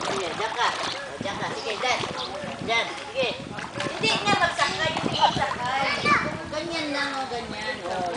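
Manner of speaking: gentle hushed encouragement; soft coaching. Real, not acted. Dad softly coaxes the baby to hold on in the water.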